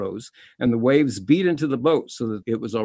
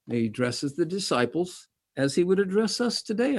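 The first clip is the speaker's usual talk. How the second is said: ordinary talk, nothing marked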